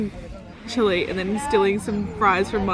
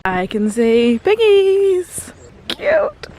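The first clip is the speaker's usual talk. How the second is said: cooing at piglets; 9 semitones higher, 6 dB louder, much slower than usual. Real, not acted.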